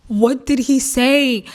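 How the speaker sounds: drawn-out, baffled question; exasperated mock disbelief. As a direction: stretch it out slowly, straining to understand. Exasperated at not hearing the whisperer.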